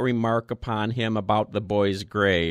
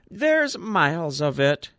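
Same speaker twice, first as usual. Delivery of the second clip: lightly voiced quavering old man, higher; a thin old voice trembling a little; 7 semitones higher than usual. A narrator's reading. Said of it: "quavered old Woodfield".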